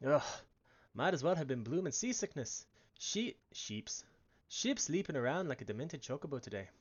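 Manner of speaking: put-on a rough, hearty Irish accent, mostly held. Brennan the peddler waking the lass on the ship, in an Irish accent. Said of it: "I think it's Irish".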